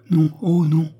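mildly acted anguish, short of real sobbing; tearful denial. A narrator's reading. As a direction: protest it as if close to sobs. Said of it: "Et Cerise sanglota".

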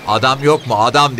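high, loud call for someone. A narrator's reading. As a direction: holler for a man to come. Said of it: "Hey, kimse yok mu? diye bağırdı".

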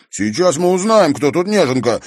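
loud, high, fighting retort; worked-up and defiant; heated challenge. A narrator's reading. Boy clenches fists, challenging.

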